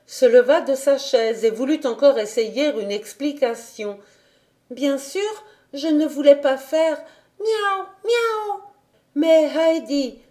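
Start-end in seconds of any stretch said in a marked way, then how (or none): 4.7-7.0 put-on high child's protest; pleading little girl. A narrator's reading. Heidi tries to explain to the governess, pleading child. 7.4-8.6 very high little mews; kitten meows. A narrator's reading. Kittens cut into Heidi's explanation, high meows. 9.2-10.2 put-on high, slow girl's voice; questioning girl. A narrator's reading. Clara questions Heidi about the meowing, high and slow.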